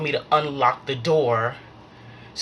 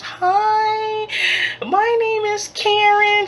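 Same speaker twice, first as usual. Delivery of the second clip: Karen; 5 dB louder, much slower than usual. Retold or imitated. Invitation Homes lady phoning the renter, high put-on voice. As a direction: put on a much higher, louder customer-service greeting.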